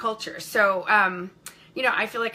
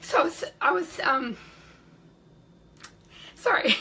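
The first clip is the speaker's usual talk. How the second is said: tearful; 6 semitones higher than usual. Real, not acted. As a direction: laugh through welling tears, barely voiced. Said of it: "I'm gonna cry".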